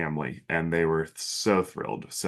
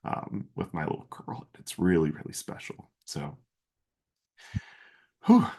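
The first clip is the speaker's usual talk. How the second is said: emotional. Real, not acted. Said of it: "I'm an emotional man".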